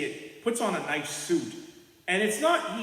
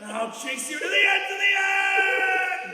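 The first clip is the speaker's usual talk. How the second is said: high, loud drawn-out threat; villain shout; 13 semitones higher, 5 dB louder, much slower than usual. Acted. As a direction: stretch the last words, menacing. S0 voicing the villain.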